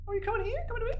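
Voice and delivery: very high cooing pet voice. Real, not acted. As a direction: coo at the cat coming over. Baby talk to his cat.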